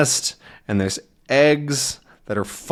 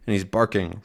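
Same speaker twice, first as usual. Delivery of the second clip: usual speaking voice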